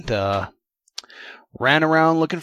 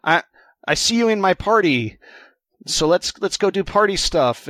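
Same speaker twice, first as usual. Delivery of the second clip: higher-pitched mock friendly invitation; imitating party invite; 6 semitones higher, 4 dB louder than usual. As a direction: lightly act out inviting a friend along. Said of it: "You know, I would invite Josh".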